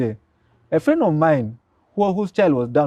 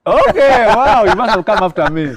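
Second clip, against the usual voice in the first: high, loud delighted surprise; host was at his school too, delighted surprise; surprised; 7 semitones higher, 8 dB louder than usual. Real, not acted. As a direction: react amazed at the coincidence. Learns host attended his school.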